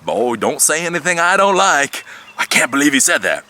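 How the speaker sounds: play-scold the speaker from over his shoulder; lightly voiced Louis warning. Louis watching the video, mildly mock stern warning. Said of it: "Louis is watching the video".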